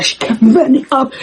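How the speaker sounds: ordinary delivery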